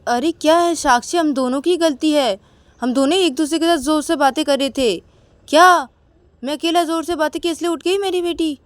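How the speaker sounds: put-on husband argues with wife over the crying baby; arguing husband. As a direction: argue it was both your faults. Quarrel, Akash argues.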